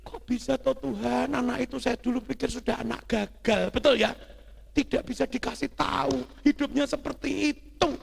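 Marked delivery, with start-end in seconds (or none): 0.1-3.7 high-pitched mock weeping; raised, tearful voice, acted out; father crying. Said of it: "papanya langsung nangis". 4.7-7.9 high-pitched mock weeping; raised, tearful voice, acted out; father crying. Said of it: "papanya langsung nangis".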